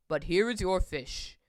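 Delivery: low-pitched character voice; deep, matter-of-fact man; Mister Carp handing over. A narrator's reading. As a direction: speak deep, as the knowing older man.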